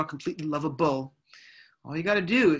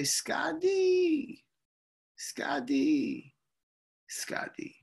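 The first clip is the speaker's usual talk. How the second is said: high call fading to a whisper; aunt calling name; 9 semitones higher, 4 dB quieter, much slower than usual. Retold or imitated. Great aunt calling from Brooklyn, high then fading to a whisper. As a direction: call him like an old aunt, dying away.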